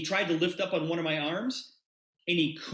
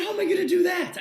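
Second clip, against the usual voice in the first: high-pitched, louder retold outburst; baffled protest; 13 semitones higher, 5 dB louder, faster than usual. Speaker retells protesting to Jay about explaining it, baffled. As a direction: throw the question up high, incredulous.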